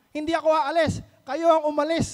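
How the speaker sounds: imitating a loud, high defiant retort; defiant refusal. Tenant asked for rent retorts defiantly, loud imitated defiance. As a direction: refuse to leave, loud and pushing back. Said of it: "ang sabi niya".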